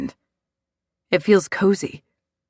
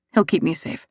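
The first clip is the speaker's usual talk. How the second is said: usual speaking voice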